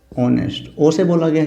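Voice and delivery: usual speaking voice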